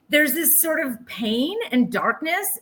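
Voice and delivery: ordinary talk, nothing marked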